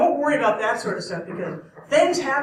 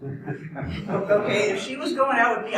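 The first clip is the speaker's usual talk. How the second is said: usual speaking voice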